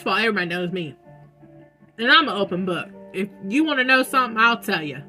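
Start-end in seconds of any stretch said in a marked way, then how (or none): none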